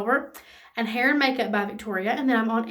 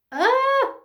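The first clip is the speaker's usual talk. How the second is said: excited squeal; 13 semitones higher, 4 dB louder than usual. Real, not acted. Gushing over lipstick shade.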